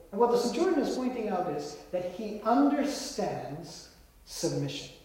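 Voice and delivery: ordinary delivery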